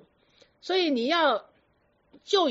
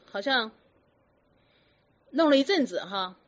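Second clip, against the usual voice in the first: ordinary talk, nothing marked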